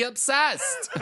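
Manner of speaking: high-pitched excited squeal of a word; gushing emphasis. Acted. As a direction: burst out with it, half laughing. Emphatic reading of gushing listener review.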